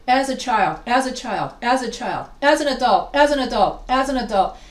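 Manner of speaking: loud, chant-like repetition, each phrase on the same falling tune; mimicked repetitive student writing. Teacher mocking students' repetitive essays, monotonous hammering. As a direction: hammer out each repeat identically. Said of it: "And I always get".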